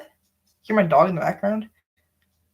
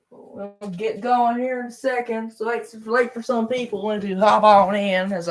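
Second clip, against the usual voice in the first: playful put-on Southern accent, higher; fake Southern accent; 6 semitones higher than usual. Streamer to late joiners in a fake Southern accent. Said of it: "fake a Southern accent".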